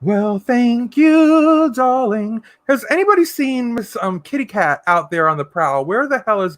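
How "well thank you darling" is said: slow, loud and higher, drawn out grandly; hammed-up gracious thanks; diva thank you. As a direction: play the flattered diva.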